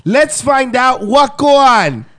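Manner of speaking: high, slow hammed-up Jamaican accent; mock Jamaican accent. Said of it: "My voice, I can't try".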